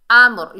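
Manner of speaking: अम्र loud, with a thick rounded final r; heavy ra. Shown as a demonstration. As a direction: stop firmly on the r of अम्र. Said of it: "रा को मोटा पढ़ेंगे".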